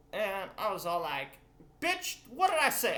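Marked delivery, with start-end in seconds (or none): 1.8-3.0 loud, high, exaggerated mock yell; angry yell. Retelling how he refused his ex-girlfriend, mock angry shout. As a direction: bark it out like an outraged boyfriend in a retelling. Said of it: "I didn't say it like that".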